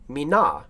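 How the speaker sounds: "Mina" stressed on the last syllable, mee-NAH; shifted accent. Shown as a demonstration. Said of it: "put an accent in there".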